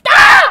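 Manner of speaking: very high, loud scream; unhinged scream. Real, not acted. Gamer losing his sanity on camera, unhinged scream. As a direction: scream as if sanity has gone. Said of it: "The sanity has left the building".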